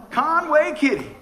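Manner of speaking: loud, high CONWAY KITTY; slow, punched-up name reveal; emphatic announcement. Real, not acted. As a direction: belt the name out proudly to the room. Repeats the name for effect.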